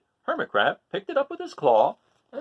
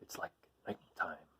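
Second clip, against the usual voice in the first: hushed whisper in a lower voice; sea urchin whisper; 9 semitones lower, 15 dB quieter than usual. Acted. Sea urchin in the dark seaweed forest, whispered low. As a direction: breathe it out low, no voice. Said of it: "cried the sea urchin".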